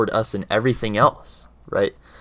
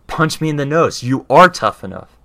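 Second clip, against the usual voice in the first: higher, louder dare that lands hard on tough enough; mother's challenge; 5 semitones higher, 6 dB louder than usual. Retold or imitated. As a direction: push him with a firm dare. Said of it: "And she said".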